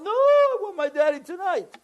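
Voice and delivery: put-on very high, wailing child voice; crying little boy. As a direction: mimic an inconsolable kid refusing. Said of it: "He didn't stop crying".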